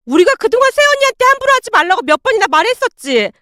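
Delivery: high, fast, wailing; sobbing shout. Acted. Daughter sobbing and yelling at her mother, wailing. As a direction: wail at her mother through tears. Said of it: "통곡을 하며 시어머님에게 소리를".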